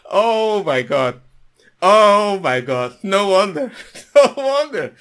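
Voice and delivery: slow, loud drawn-out oh my God; laughing exasperation. Real, not acted. Host shaking off laughter at the story, slow and loud. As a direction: savour the absurdity through laughter.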